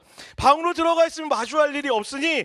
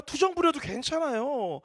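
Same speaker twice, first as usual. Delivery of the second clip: normal talking voice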